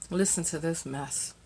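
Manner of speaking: soft disbelieving aside; hushed aside. Real, not acted. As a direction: mutter it to the audience. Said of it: "hushed aside".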